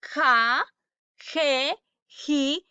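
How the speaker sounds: "Ja, je, ji" each with a throaty Spanish j; teacher models "Ja, je, ji" one at a time; spaced-out "Ja, je, ji", same j sound throughout; J syllable drill. Shown as a demonstration. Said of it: "¿Cómo se pronuncia la J?".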